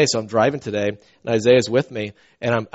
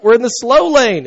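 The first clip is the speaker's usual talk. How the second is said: irritated yell; 11 semitones higher, 7 dB louder than usual. Retold or imitated. Retold yelling at tailgating truckers.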